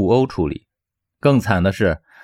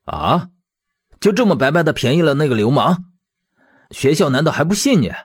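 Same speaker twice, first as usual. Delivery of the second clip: outraged, higher-pitched questioning; indignant; 5 semitones higher than usual. Acted. Man hearing his friend was fired, indignant. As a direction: protest the injustice in disbelief. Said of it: "愤愤不平的道".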